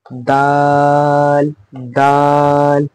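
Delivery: दाल drawn out very slowly; a teacher stretching दाल for pupils; stretched daal. Shown as a demonstration. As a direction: hold दाल long and even. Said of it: "दाल को भी खींच कर".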